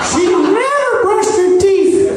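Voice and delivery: high, piping insult in a put-on voice; mock outburst in a silly high voice; high-pitched stepdad. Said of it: "one of those really high-pitched voices".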